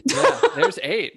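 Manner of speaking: high, laughing repeat of the quip; laughing echo. Real, not acted. Echoing her co-host's joke through laughter.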